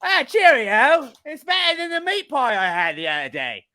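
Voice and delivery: a mock British toff savoring fish and chips; British accent. Mocking British fans of fish and chips, hammed-up British accent.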